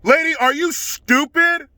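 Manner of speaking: slow, high exasperated jab; exasperated disbelief. Real, not acted. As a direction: drag it out in disbelief. Exasperated at dog owner.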